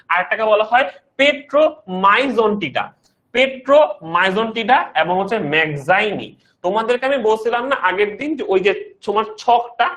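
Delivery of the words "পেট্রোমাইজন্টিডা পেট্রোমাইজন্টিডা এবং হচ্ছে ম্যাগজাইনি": slow careful naming. Shown as a demonstration. Teacher spelling out terms slowly.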